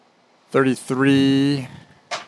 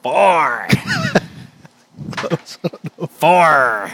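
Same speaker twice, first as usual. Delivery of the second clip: flower voice; 4 semitones higher than usual. Pretend. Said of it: "Use the voice".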